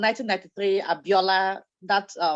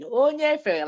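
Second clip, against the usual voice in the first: mild mock yell, pitched up; retold scream; 7 semitones higher, slower than usual. Stranded girl calls for a canoe, retold scream. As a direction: imitate a higher cry for help, lightly. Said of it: "we were screaming".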